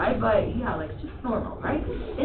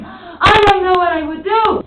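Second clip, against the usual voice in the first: high, loud theatrical wail; exaggerated intonation; 8 semitones higher, 13 dB louder than usual. Shown as a demonstration. Teacher urges the class one more time, exaggerated high shout. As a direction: overdo it as a big mock cry of despair.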